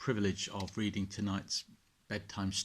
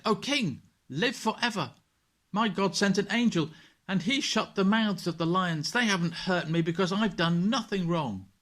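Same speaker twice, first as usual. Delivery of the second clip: much higher and louder, joyful call from below; Daniel calling up; 11 semitones higher, 7 dB louder than usual. Acted. Daniel answering the king from the lions' den, joyful call.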